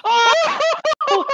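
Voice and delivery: high, laughing cheer; excited laughing praise. Real, not acted.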